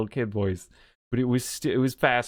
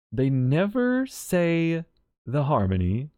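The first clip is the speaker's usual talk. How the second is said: usual speaking voice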